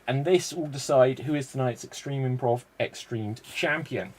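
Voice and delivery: normal talking voice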